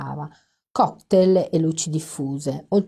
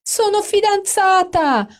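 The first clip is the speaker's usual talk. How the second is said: thrilled announcement; 7 dB louder, slower than usual. Acted. Announces her engagement.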